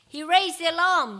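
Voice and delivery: loud, high call-out; crowd member's shout. A narrator's reading. Another listener offers Jesus a guess, shouted. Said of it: "shouted another".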